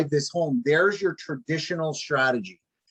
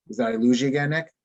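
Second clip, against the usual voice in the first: usual speaking voice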